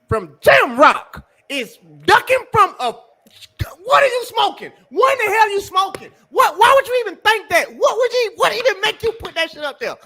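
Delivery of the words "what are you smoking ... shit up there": shouting in disbelief, pitch thrown up high; furious, incredulous yelling; host shouting at the viewer's question in outrage; outraged. Real, not acted.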